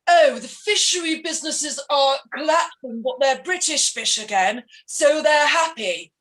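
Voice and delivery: slow, mock-cheery proclamation; mocking politician. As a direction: send up a politician's speech, slowly. Mockingly quoting a politician's speech.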